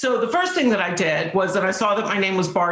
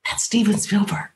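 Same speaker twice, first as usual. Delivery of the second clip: whispering. Retold or imitated. Whispered aside to scared actress.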